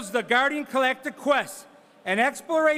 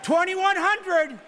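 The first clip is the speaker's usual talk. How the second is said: brisk auctioneer patter pushing for the next bid; auctioneer bid calling; 6 semitones higher than usual. Real, not acted. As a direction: call the bids like a charity auctioneer. Auctioneer running live bidding.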